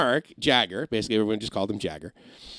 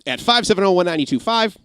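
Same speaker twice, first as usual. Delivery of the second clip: smooth radio voice giving the number, a bit louder; cooler DJ voice; 6 semitones higher, 5 dB louder than usual. Retold or imitated. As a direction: play it cool on air, like a DJ chasing a date. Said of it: "that was more like it".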